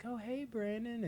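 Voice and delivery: high, slow, overly nice greeting; butter the kid up; mock sweet grandma. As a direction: imitate a suspiciously friendly grandma. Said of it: "oddly nice".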